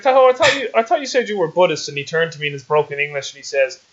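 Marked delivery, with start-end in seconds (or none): 0.0-1.9 mock-shocked high call of his name; surprised disbelief. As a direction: catch him out, stunned. Shocked at Buddhist eating meat.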